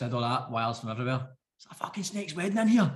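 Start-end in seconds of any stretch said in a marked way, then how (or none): 1.6-2.9 lightly voiced exasperated dad, pitched up; a hint of dad complaining, voice lifted; dad's exclamation. Son quoting his dad about wires everywhere, lightly voiced dad. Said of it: "my dad used to always say".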